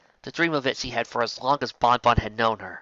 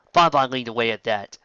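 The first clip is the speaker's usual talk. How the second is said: ordinary delivery, nothing marked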